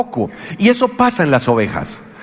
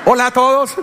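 silly greeting; 7 semitones higher than usual. Retold or imitated. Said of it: "Uno es ridículo".

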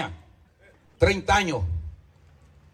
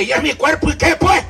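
defiant retort; 8 semitones higher than usual. Retold or imitated. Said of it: "Ahí habla así".

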